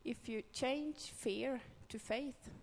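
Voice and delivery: slow, deliberate English quote; quoted in English. Retold or imitated. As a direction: spell it out slowly in English. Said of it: "nu ska vi prova på engelska då".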